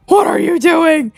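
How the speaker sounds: alarmed shout at mom. Retold or imitated. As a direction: keep yelling at her. Repeated panicked reaction.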